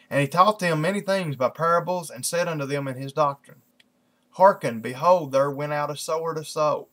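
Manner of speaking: lifted, measured Bible-reading voice; scripture reading. Acted. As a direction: read the verses out to a congregation. Reads scripture aloud.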